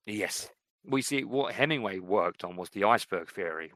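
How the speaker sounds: usual speaking voice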